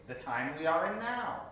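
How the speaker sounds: ordinary talk, nothing marked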